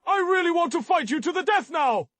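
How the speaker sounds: high, loud mock fury; overacted anime dub. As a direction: rage like a cartoon rival. Comic threat after being outdone.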